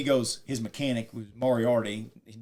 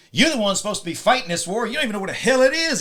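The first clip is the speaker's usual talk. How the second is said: loud, high, retold general's tirade; berating outburst; 9 semitones higher, 6 dB louder than usual. General scolding officers who should be fighting, loud outrage. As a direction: chew them out, imitating a fed-up general.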